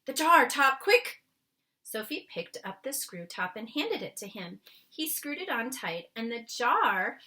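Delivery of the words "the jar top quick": high loud hurried call; urgent giant's call, higher and louder. Acted. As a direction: rush her for the lid. Urgent request.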